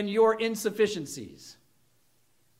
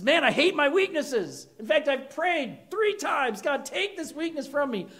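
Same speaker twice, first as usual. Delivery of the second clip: Paul's plea, higher, louder and slow; acted Paul pleading; 9 semitones higher, 5 dB louder than usual. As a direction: pour out Paul's frustration and prayer. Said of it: "He says".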